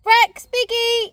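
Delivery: calling out. Real, not acted. Calling the dogs outdoors.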